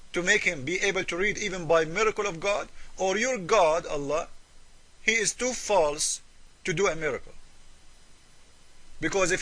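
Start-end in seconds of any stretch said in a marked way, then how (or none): none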